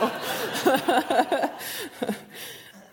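high, loud burst of laughter; real laugh. Real, not acted. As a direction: laugh out loud at your own example. Laughs at own hypothetical example.

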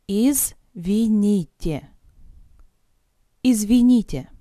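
ordinary delivery

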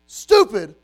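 building scornful shout. Retold or imitated. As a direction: hammer the word stupid harder each time. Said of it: "I just go".

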